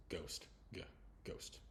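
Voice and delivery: "ghost" starting on a hard G with no H sound; hard G, silent H. Shown as a demonstration. Teacher showing students the silent H in "ghost", hard G. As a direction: isolate the "G" between two careful takes of "ghost". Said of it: "a hard G, and a silent H".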